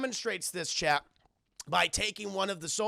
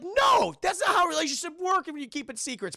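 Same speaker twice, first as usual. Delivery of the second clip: high, fast shouted objection; loud protest; 9 semitones higher, 5 dB louder than usual. Retold or imitated. Mock protest at husband's line.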